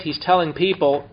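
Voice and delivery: ordinary delivery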